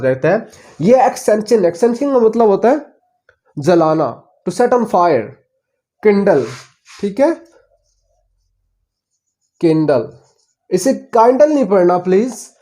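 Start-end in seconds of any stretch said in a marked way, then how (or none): none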